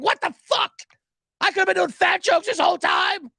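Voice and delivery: high-pitched outraged whine of complaint; mock pissed-off. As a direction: imitate people furious at being cheated, voice way up. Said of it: "People were pissed".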